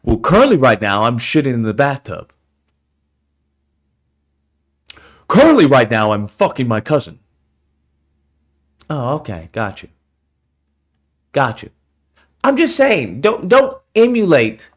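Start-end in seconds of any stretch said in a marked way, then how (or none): 0.0-7.2 much lower deadpan mock voice; mock deep confession. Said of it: "What are you doing right now?". 8.9-11.7 sarcastic. Acted. Sarcastic reaction.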